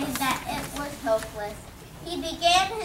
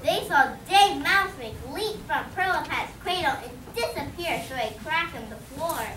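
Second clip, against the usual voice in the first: usual speaking voice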